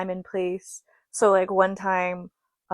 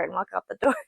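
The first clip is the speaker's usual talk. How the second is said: ordinary talk, nothing marked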